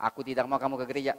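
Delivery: fast, loud forbidding husband; angry husband. Acted. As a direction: snap the ban out quickly. Husband forbids wife going church.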